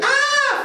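very high-pitched shriek of fear; hammed-up scream while fleeing a spider; mock terrified scream. Acts running away from spider.

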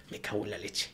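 cursing under the breath; muttered curse. Retold or imitated. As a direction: swear quietly to yourself. Guards cursing under their breath.

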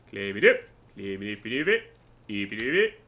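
"Climb it up" as a slurred babble rising at the end; quirky old-time pronunciation. Acted. Player climbing up and over, silly "move it" gabble with rising ends. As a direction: flick "up" and "it" upward in a silly gabble. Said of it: "That's how we pronounce up and over back in the day".

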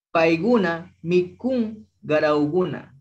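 Paiguna mikun garauguna, slow, g sounds clear; careful slow model for repetition; teacher drawing out each syllable; slow model pronunciation. Shown as a demonstration. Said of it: "pronunciarlo con la G de gato".

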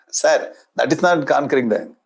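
normal talking voice

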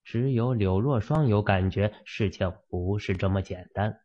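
ordinary delivery

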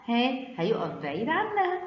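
ordinary delivery

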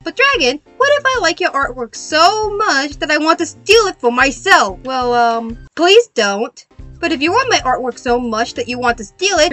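ordinary talk, nothing marked

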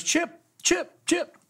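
high eager calls of a name; calling out. Retold or imitated. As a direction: call him over excitedly. Pilot calling him over.

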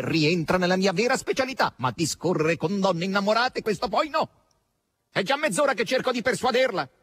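high-pitched exasperated complaint. A narrator's reading. Asasello complains of persuading women, exasperated. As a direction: let the pitch climb with exasperation.

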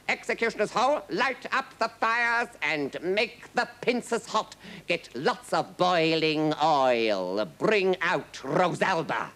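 slow, high, ringing commands; livid king. A narrator's reading. King summoning the executioner for Rosalba, livid. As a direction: call out each order with relish. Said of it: "now livid with rage".

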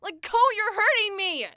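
high, loud, pained protest; distressed cry. Acted. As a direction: cry out to break free. Struggling against pushy ex.

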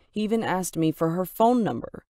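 ordinary delivery

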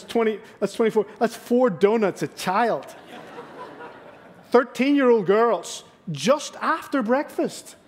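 exasperated dad's outburst at the donut math. Real, not acted. Outraged over the donut count.

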